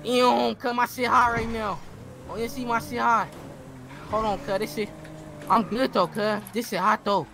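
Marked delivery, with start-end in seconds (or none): none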